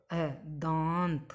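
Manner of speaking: दांत drawn out very slowly after its sounds; slow spelled demo. Shown as a demonstration. Teacher sounding out दांत for the class, slow. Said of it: "दांत का हम वर्ण विच्छेद करें".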